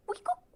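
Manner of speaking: high squeaky week-oop; dentist tool noise. Retold or imitated. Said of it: "he just went".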